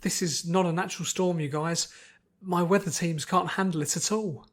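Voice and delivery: lightly voiced anxious pony at the storm; worried pony voice. Pony warning friends of the storm, worried.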